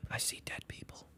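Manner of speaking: breathy spooky whisper. Pretend. Quoting the creepy film line in a whisper. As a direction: whisper it like a ghost story.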